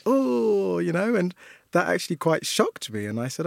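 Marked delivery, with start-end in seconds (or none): 0.1-0.8 a high, loud, recoiling oh of disgust. Retold or imitated. Teacher imitating class reacting to baby picture, high disgusted oh. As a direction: sneer the oh like a grossed-out child. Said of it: "their reaction was disgust".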